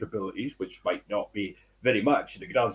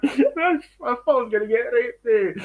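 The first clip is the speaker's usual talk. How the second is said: laughing; 12 semitones higher, 4 dB louder than usual. Real, not acted. Laughing at own scary story.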